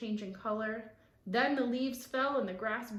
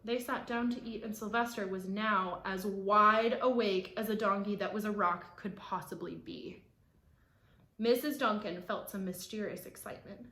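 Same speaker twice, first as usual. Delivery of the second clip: usual speaking voice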